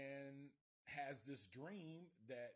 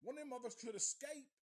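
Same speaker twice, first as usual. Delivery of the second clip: put-on scared kid's rant; 10 semitones higher, 5 dB louder than usual. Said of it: "That's what we're thinking".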